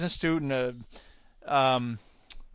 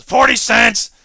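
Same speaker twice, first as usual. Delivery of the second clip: loud, much higher bidding cry, stretched slow; a cartoon sister shouting her bid, loud and high; Patty yelling; 11 semitones higher, 10 dB louder, slower than usual. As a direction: shriek out the bid across the room. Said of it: "Patty goes".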